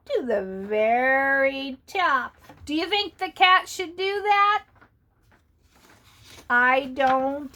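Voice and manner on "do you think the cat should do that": ordinary delivery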